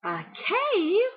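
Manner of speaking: high-pitched burst of discovery; excited cry. A narrator's reading. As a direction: exclaim it high as Blue Rabbit. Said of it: "cried Blue Rabbit".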